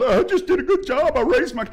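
high, loud mocking parent; mocking smug dad. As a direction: mimic a smug father, pitched high. Mocking self-satisfied dad.

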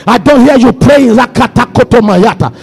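relentless, rhythmic full-force praying; fervent prayer leading. Real, not acted. Preacher pushing the congregation to pray, fervent prayer leading. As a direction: drive the prayer on without letting up.

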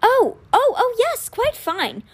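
high-pitched, flustered realization; brightened. A narrator's reading. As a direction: brighten suddenly from puzzlement. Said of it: "then his face brightened".

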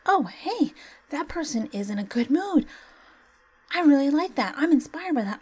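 high, bright-eyed imitation of someone cheering up; mock delighted onlooker. Talking about people catching good vibes, mock delighted voice. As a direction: play a stranger lifted by your good mood.

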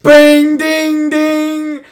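high, loud sung bell chimes, loudest on Bing; imitated bell. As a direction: ring out like a church bell announcing death. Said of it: "That's a great bell".

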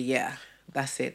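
soft, slow, done with it; fed-up resignation. Retold or imitated. To her date, quiet and slow, calling it quits. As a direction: let it drop, quietly finished.

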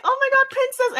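high-pitched doting pet voice; acted gushing. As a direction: coo at a puppy, way up high. Gushing at favourite puppy.

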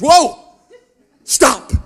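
sudden loud, high "Whoa! Stop!" from the officer; a startled agent yells at the traveller to stop; retold shout. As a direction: bark it out like a startled customs agent. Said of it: "the person behind there goes".

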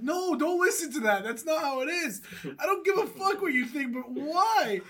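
high-pitched, exasperated acted denial; indignant protest. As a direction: insist urgently, pitched well above chat. Retold denial to the girl.

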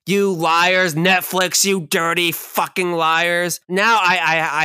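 loud, hammed-up accusation; mock rant. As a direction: yell at Netflix in fake fury.

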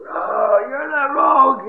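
loud, high-pitched accusation; outraged discovery. Acted. Villagers about to jump on the thief, acted outrage. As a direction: rise into an acted outcry.

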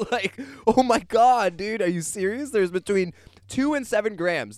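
high, loud, frantic repeat of the number; panicked disbelief. Retold or imitated. Realizing the dose, he panics in high loud disbelief. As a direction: spiral into panic over the dose.

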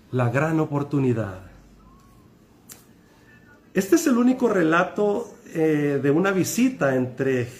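ordinary talk, nothing marked

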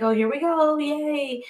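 sarcastic yay. Retold or imitated. To a friend about boring app messages, sarcastic mock cheer. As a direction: fake excitement she doesn't feel. Retelling her dating app replies.

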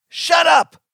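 very high, loud outburst; yell. A narrator's reading. Child silences mother with forbidden words, yelling. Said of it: "I yell".

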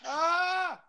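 sudden high yelp; startled yell. Real, not acted. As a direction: cry out as you're hit. Getting shot in game.

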